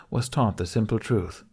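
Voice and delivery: ordinary delivery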